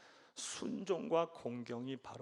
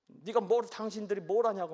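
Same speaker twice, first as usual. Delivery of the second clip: higher, louder talking back; defiant shout; 6 semitones higher, 7 dB louder than usual. Retold or imitated. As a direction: yell at the parents. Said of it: "삼손의 입장에서". (부모에게 소리치며 대들기)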